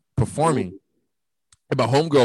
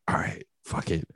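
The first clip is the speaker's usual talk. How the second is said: resigned mutter; 6 semitones lower, 10 dB quieter than usual. Retold or imitated. Resigning himself, drunk.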